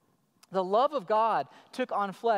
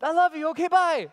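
high, bright-voiced quick I love you; little daughter; 8 semitones higher, 8 dB louder than usual. Daughter popping in to tell dad she loves him, higher child voice. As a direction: toss it off like a happy little girl. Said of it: "And she'll be like".

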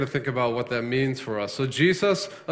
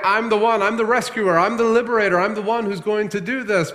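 higher and louder, ringing claims; preacher voicing Jesus' claims, proud and loud; bold proclamation; 7 semitones higher, 4 dB louder than usual. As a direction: announce it like a champion.

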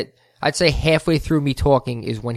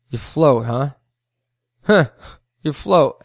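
mocking; 5 dB louder, slower than usual. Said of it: "such a mocking way".

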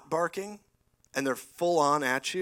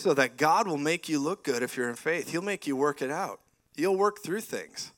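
normal talking voice

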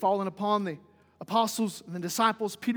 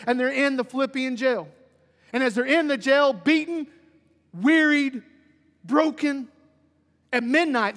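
raised, loud, emphatic pulpit delivery; forceful preaching; 2 semitones higher, 5 dB louder than usual. Real, not acted. Preacher recounting Paul and Silas in jail, forceful. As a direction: drive each phrase home to the congregation.

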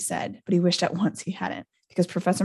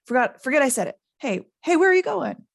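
higher, louder at the end; stumbling retraction rising into a worried shout; flustered calling; 7 semitones higher, 4 dB louder than usual. Acted. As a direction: call after them in alarm. Hagrid alarmed, calls after them.

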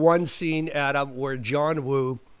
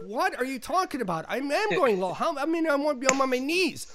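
high-pitched pleading protest; mock exasperation; 9 semitones higher than usual. Stand-in on his knees answering director, mock exasperated. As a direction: act out an indignant, strained comeback. Acted protest, crouching in pain.

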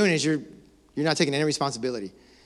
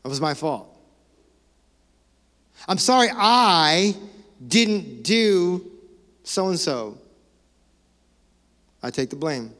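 earnest, humble apology with long pauses; sincere apology; slower than usual. Shown as a demonstration. Pastor modeling a real apology for couples, sincerely. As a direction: show the congregation how a real apology sounds. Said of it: "sounds like this".

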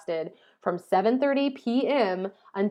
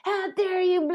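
imitating a possessed scream; 11 semitones higher, slower than usual. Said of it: "She was cursing".

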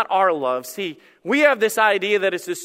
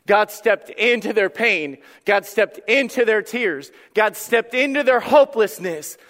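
raised, louder, hammered refrain; impassioned preaching; 5 semitones higher, 6 dB louder than usual. Real, not acted. Preaching at full force.

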